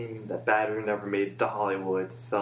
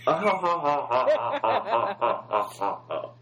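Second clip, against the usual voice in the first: mock audience laughter; 7 semitones higher, 4 dB louder than usual. Mocking studio audience laughter.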